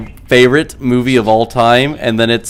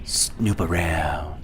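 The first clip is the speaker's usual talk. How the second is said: much lower, very slow "Snoop around"; slow low drawl; 6 semitones lower, 12 dB quieter, much slower than usual. Retold or imitated. Quoted movie line again.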